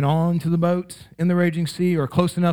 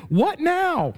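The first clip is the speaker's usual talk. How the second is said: sudden worry; 13 semitones higher than usual. Retold or imitated. Sudden panic after elation.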